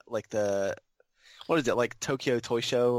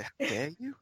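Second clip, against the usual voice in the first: mock outrage; 5 semitones higher, 3 dB quieter than usual. Voices an outraged anonymous letter.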